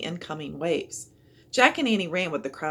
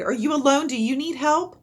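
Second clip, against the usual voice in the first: louder, higher concerned call; 8 semitones higher, 7 dB louder than usual. A narrator's reading. Father calling to Jack in the flood, a loud concerned call. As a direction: shout your offer over the noise. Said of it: "the boy's father cried".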